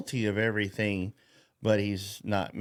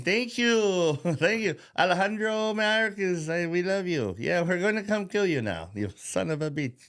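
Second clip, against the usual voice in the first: much higher mock-cheery thank-you voice; mock grateful migrants; 8 semitones higher than usual. Migrants passing the border chief, high mock gratitude. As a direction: gush thanks, then turn menacing. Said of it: "as they're going".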